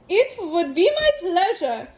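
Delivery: hammed-up German accent, pitched high. A narrator's reading. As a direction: play an eager computer with a comic German accent. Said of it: "high-pitched German accent".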